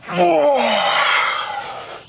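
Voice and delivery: long, high sigh while stretching; drawn-out, held stretch sigh; stretching groan. Real, not acted. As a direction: let out a big high-pitched stretch noise. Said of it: "Big stretch".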